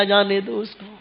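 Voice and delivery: fading forgiveness. Acted. Prophet forgives, voice fades.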